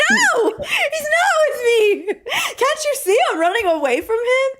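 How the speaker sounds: high, laughing protest; mock exasperation. Replaying her answer to the cashiers, mock exasperation. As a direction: squeal it in hammed-up disbelief.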